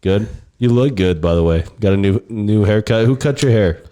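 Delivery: ordinary talk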